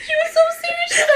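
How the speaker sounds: high squeaky laugh-talk. Real, not acted. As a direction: still cracking up. Laughing fit while retelling.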